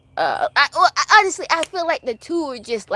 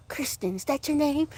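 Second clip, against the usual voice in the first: imitating Alexis; 4 semitones higher, 8 dB quieter than usual. Alexis snubbing Crystal.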